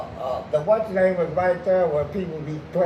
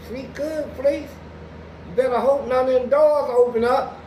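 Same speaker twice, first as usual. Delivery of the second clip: higher, louder mocking warning; taunting guard; 9 semitones higher, 6 dB louder than usual. Guard taunting the new inmate on the walk, high mocking warning. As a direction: tease him about the open doors.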